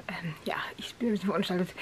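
fast unvoiced whisper. Real, not acted. Half-asleep vlogger whispering to the camera about her messy look. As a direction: whisper it quickly, a little embarrassed. Whispered aside in morning vlog.

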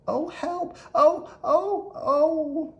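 very high, loud frantic squeals; panicked cries. Acted. The mouse flees through the city, squealing for help. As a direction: squeal in panic while running.

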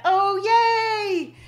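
high, loud squeal of delight; thrilled yay at seeing friends. Real, not acted. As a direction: cheer as friends join the stream. Sees favourite viewers arrive.

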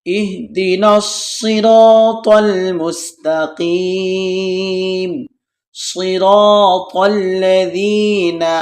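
slow, drawn-out tajweed chant; slow melodic Quran recitation. Shown as a demonstration. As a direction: intone each word slowly. Teacher recites Quran slowly.

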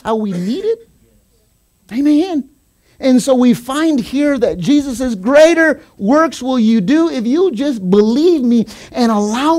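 high, loud pulpit exhortation; impassioned preacher, ringing out key words; fervent preaching. Real, not acted. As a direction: drive the promise home to the congregation. Preaches with full force.

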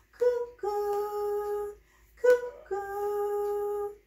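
high, loud cuckoo call; bright high cuckoo for the baby. Acted. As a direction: sing out like a cuckoo clock. Cuckoo clock strikes.

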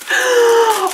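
high, tearful gasp of surprise; overjoyed gasp. Real, not acted. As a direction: gasp as if about to cry. Sees a gift she wanted.

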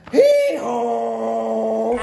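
donkey bray. Acted. Donkey bray in read-aloud.

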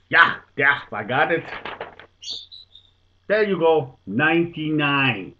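put-on old Dutchman proudly announcing ninety-nine; triumphant Dutchman. Dutchman presents his answer.

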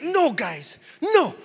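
high, loud mock protest; upset child. Son protesting to the family, imitated upset. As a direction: imitate a little boy objecting. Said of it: "Elias got really upset".

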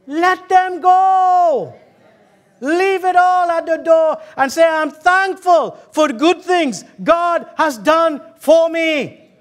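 high, drawn-out preacher's proclamation; full-force preaching. Acted. Preacher urging people to let bad years go, preaching hard. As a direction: send each phrase out to the whole church.

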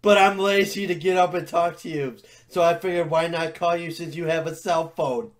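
ordinary talk, nothing marked

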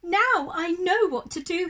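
little bear's high, loud discovery; excited cry. Acted. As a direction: burst out with the sudden idea. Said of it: "he cried".

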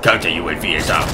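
lightly put-on low announcer voice; mimicking game announcer. Player repeats the game's Counter UAV callout, mock announcer.

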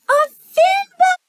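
high, slow, thrilled cry; excited squeal. Acted. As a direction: squeal it with delight. Excited at fin bump idea.

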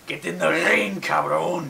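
mock wrestling call-out; masked luchador. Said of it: "masked characters just run up and said".

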